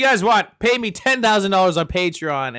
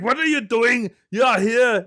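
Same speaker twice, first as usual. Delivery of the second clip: higher, louder mock Austrian accent; Arnold Schwarzenegger accent; 6 semitones higher, 5 dB louder than usual. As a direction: imitate an actor slipping into a bad Austrian accent. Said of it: "Arnold Schwarzenegger accent".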